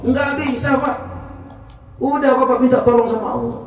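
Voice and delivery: high, insistent preacher's push; fervent urging. Retold or imitated. Amir urging the speaker to ask God for help, fervent and high. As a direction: lift him up, urge him on with fervor.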